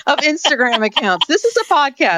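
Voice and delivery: high-pitched exasperated complaint; half-laughing protest at her co-host. Real, not acted. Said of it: "this isn't very good for a podcast".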